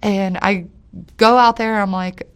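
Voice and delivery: ordinary talk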